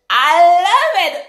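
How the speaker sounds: slow, high and loud gush; thrilled. Real, not acted. Telling viewers she loves the gift, slow high thrilled gush. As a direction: savor each word, swept away. Reacting to the product.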